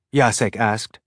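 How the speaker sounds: ordinary delivery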